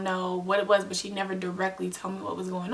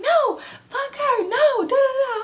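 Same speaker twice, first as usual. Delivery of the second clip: much higher, louder, slowed defiant yell; retold defiant shout; 7 dB louder, slower than usual. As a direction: shout back that you won't stop. Refusing to stop fighting.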